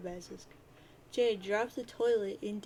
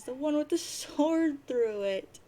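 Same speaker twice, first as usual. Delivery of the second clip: high, loud, slow mock distress; dismayed lament; 6 semitones higher, 6 dB louder, slower than usual. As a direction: stretch it out in hammed-up dismay. Jade laments the impaled bird.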